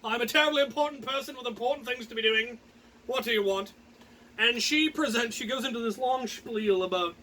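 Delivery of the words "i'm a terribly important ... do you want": smug self-important. Retold or imitated. Carried into court, acting smug and self-important. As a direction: act the self-important lord on his chair. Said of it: "all smug, like".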